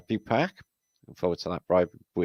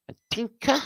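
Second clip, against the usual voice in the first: Tinker called out much higher, in a playful squeak; squeaky call-out. Acted. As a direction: sing out Tinker in a high, teasing squeak.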